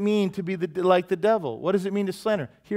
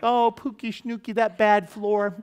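put-on higher, slow baby-talk coo; cooing mom; 6 semitones higher, 3 dB louder than usual. Mom comforting hurt child, high slow baby talk. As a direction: coo like a doting mother. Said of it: "the mom's like".

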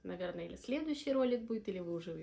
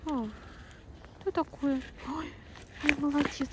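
squeaky praising a dog; 6 semitones higher than usual. Real, not acted. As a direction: coo молодец to the dog.

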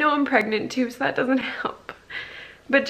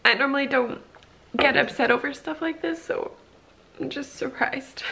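normal talking voice

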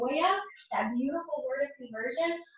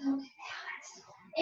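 slow unvoiced whisper; soft plea; 10 dB quieter, much slower than usual. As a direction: hush her gently, very slow. Gently stopping a friend's self-shame.

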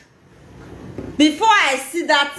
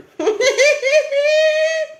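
long, very high-pitched cackle; mocking laugh; 13 semitones higher than usual. Real, not acted. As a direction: laugh high and drawn-out at a fool. Laughs after calling someone fool.

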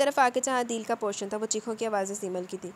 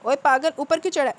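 a loud, higher angry yell up at him; angry shout; 5 semitones higher, 8 dB louder than usual. Acted. Salar yelling angrily at Adeel on the terrace. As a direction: bark the question at the fool on the roof. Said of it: "Salaar ने गुस्से से पूछा।".

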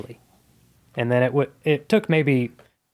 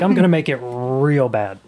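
put-on thick Southern accent; 5 dB louder than usual. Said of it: "I started putting on a thick accent".

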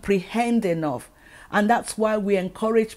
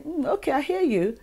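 high, vague fake agreement; faking understanding; 7 semitones higher than usual. Listener faking that they understand, mock agreeable. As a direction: pretend to follow along, pitched up. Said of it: "They might fake it and say".

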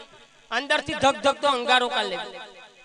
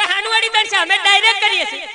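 mock outrage; 6 semitones higher, 6 dB louder, faster than usual. Drunk protests electricity bill.

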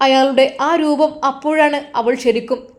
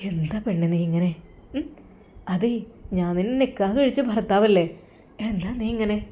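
low, soft coaxing husband; speaking very softly to his new bride, low and tender; 3 semitones lower, 6 dB quieter than usual. Acted. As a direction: gently reassure his frightened wife. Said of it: "വളരെയധികം സോഫ്റ്റായിട്ടാണ് സംസാരിക്കുന്നത്".

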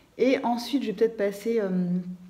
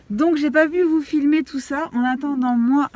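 excited; 6 semitones higher, 6 dB louder than usual. Real, not acted. Excited vlog update.

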